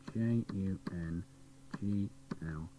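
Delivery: letters whispered, each one apart; whispered spelling. Real, not acted. Presenter spelling jungle to the jukebox, whispered.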